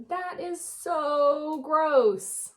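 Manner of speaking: put-on a kid bug saying ew, slowly; grossed-out worm. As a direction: drag out the disgust like a squeamish worm. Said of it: "and he's saying".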